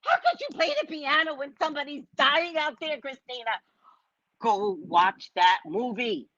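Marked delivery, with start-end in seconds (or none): 0.1-3.5 high-pitched reproach, trailing softer; mock anguished scolding; appalled mother. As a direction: play a horrified mother chiding her child. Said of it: "the mother comes over".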